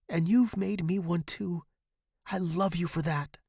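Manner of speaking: high, earnest reassurance; impassioned devotion. A narrator's reading. Student to dying teacher, earnest devotion. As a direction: plead earnestly, softening by the end.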